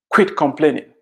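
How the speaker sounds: normal talking voice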